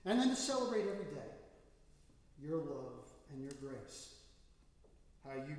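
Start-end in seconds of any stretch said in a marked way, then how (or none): 0.1-1.3 fast, loud and high burst of praise; fervent. Real, not acted. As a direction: lift the prayer up in joy. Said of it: "climax of closing prayer".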